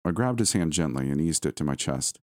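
ordinary delivery, nothing marked

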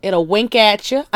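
usual speaking voice

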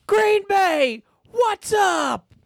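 very high arena shout; shouted catchphrase. Retold or imitated. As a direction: yell it out to the crowd. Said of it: "and he goes".

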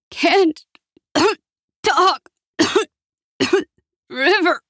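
high, strangled words between coughs; choked, broken distress; coughing, choking. A narrator's reading. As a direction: gasp out single words through coughing. Mother choking on smoke.

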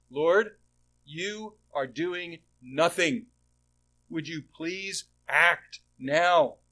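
loud, slow demand pressed on God; impatient complaint. Retold or imitated. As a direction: plead loudly and slowly, pushing for action.

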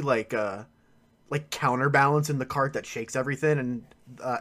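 ordinary delivery

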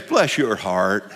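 slow, low, soft "Bless your heart"; Texan sweetness, laughing; Texas drawl. Retold or imitated. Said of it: "we'd say in Texas".

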